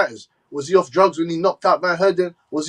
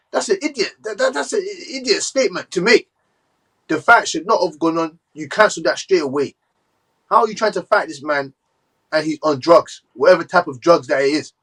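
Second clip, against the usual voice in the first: usual speaking voice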